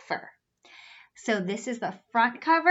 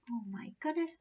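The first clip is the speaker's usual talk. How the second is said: usual speaking voice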